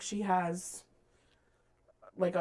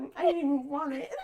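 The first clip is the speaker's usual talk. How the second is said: mock protest; 6 semitones higher, 4 dB louder, faster than usual. Voicing Feyre's protest to Alice.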